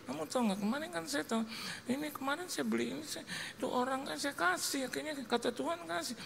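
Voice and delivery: high, soft explaining voice; imitated wife. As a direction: prattle excuses in a high, hushed mimicry. Istri menjelaskan uang yang habis.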